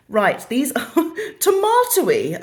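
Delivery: laughing through the words, amused; amused real laugh while reading. Real, not acted. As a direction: giggle at the odd word. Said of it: "that threw me a bit".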